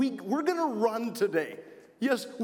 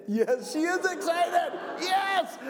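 higher, bright shout of praise; excited cheer; 7 semitones higher, slower than usual. Real, not acted. Cheering a child's answer.

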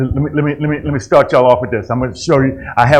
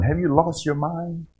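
Camille's catchphrase; 5 semitones higher, 7 dB quieter than usual. Retold or imitated. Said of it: "That was Camille's one".

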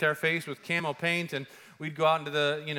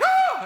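very high, loud scream of surprise; startled scream; 8 dB louder than usual. Retold or imitated. Men surprised in the desert, screaming in fright. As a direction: cry out as if caught red-handed. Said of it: "And they're like".

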